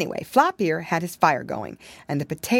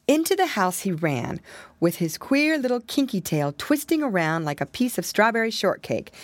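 usual speaking voice

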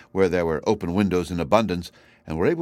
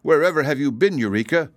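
sternly; 7 semitones higher, 4 dB louder than usual. A narrator's reading. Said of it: "asked Dorothy sternly".

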